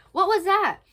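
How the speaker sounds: high, loud startled question; play-acted startled cry; acted alarm. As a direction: react to a sudden noise, stagily. Startled pirate's line in play.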